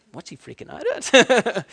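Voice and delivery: put-on high, loud, slow puzzled child voice; puzzled little brother. Calm twin puzzled by his crying brother, high child voice. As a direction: play the calm twin, baffled.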